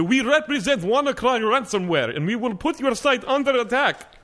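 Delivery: hammed-up Russian accent, pitched high; mock Russian accent. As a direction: put on a thick fake Russian accent. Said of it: "I can do the accent".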